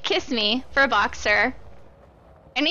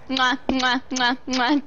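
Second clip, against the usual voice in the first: kiss sounds; slower than usual. Real, not acted. Blowing kisses to donor.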